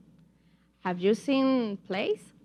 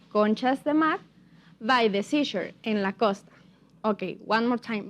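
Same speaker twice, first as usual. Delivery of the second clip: ordinary talk, nothing marked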